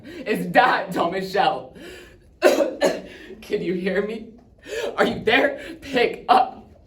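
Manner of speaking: loud, high, desperate voice broken by coughs; panicked pleading. Acted. Dad trapped, begging his child to pick up, panicked. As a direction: shout down the phone, fighting for breath. Dad's desperate last phone message.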